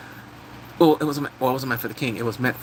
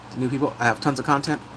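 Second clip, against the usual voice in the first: ordinary delivery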